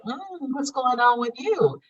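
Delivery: high, curious check-in with a friend; lightly voiced concerned friend; puzzled concern. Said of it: "and you'll be like".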